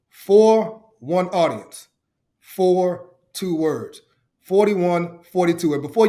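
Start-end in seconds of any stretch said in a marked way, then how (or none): 0.1-4.0 very slow, spaced-out emphasis; weighty slogan with long, stretched words; deliberate motto. Real, not acted. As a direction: state it slowly, word by word. Recites his motto for emphasis.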